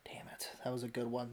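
breathy disappointed whisper. Real, not acted. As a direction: whisper it under your breath. Disappointed after rival's pick.

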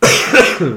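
sudden dry cough mid-sentence; cough, then excuse yourself; coughing fit. Real, not acted. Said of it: "excusez-moi".

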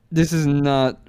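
slow, stunned repetition; numb, can't take it in; stunned disbelief. Retold or imitated. Hearing mom's news, slow stunned disbelief. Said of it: "I'm like".

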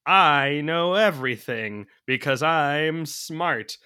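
slow, loud, mocking know-it-all; smug know-it-all. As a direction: drawl it out like a smug genius. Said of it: "this movie isn't like".

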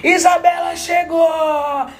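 slow, high announcing shout; excited shout. Real, not acted. Host announces guest's arrival.

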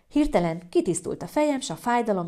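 ordinary delivery

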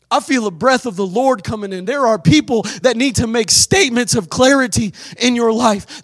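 ordinary talk, nothing marked

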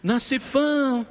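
long, high call across the hall; calling out from afar. Retold or imitated. Big church brother calling the pastor down the hall, slow high call. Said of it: "de lá ele grita".

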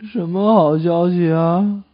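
slow, slightly drawn-out patient voice; seriously ill patient. Acted. Seriously ill patient asking the doctor about good news, slow and hopeful. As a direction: play a very sick man clinging to hope. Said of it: "住着一个重病号".

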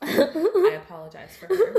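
laughing through the apology; giggling while apologizing. Real, not acted. Teasing friend, laughing apology.

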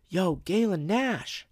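high, stretched-out hail; slow sing-out to someone across a parking lot; calling out. Retold or imitated. Stranger calling out from car.

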